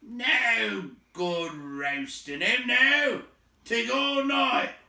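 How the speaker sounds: loud, high, slow gruff troll. A narrator's reading. Troll grumbling to the others, loud and slow. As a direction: bellow it out like a big troll. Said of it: "said a voice".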